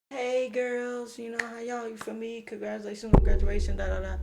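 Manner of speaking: high, chummy mock greeting to the girls; fake friendly greeting. Him and his friend greeting the girls in a high, breezy mock-friendly voice. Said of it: "and we like".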